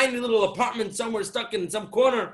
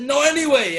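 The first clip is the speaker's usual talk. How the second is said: higher, louder mock outburst; exasperated imitation, raised short of a scream; retold screaming; 5 semitones higher, 8 dB louder than usual. Husband telling his wife about crazy people, mock screaming. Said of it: "he started screaming".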